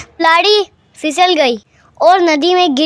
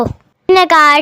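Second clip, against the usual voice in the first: ordinary delivery, nothing marked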